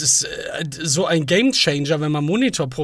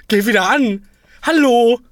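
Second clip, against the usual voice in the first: louder, higher plea at the monitor; calling out; 7 semitones higher, 5 dB louder than usual. Real, not acted. Streamer pleading with his dead monitor, louder and higher. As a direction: call out to the broken screen.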